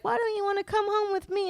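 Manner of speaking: put-on high squeaky kid voice; imitating a tiny squeaky voice; little girl voice. Aunt imitating her niece's squeaky little voice. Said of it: "that little itsy bitsy voice".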